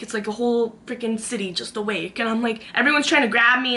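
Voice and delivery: usual speaking voice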